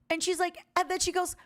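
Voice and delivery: ordinary talk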